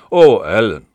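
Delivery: a loud, playful mock reproach; teasing. A narrator's reading. Mary teasing Ellen about his warning, playfully loud. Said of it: "neckte sie ihn".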